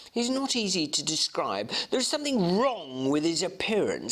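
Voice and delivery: a hint of an earnest young gentleman describing the door; young Enfield telling his tale, lightly voiced. Enfield's dialogue in read story.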